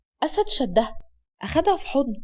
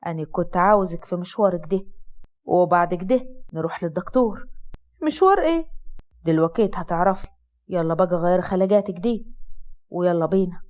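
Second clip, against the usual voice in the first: lowered, steady man's voice; Asad's low male voice; 7 semitones lower than usual. Acted. Husband comforting his sick wife, low male voice. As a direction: speak as the calm husband, low.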